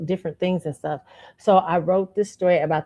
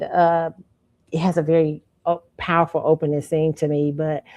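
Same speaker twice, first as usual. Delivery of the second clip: normal talking voice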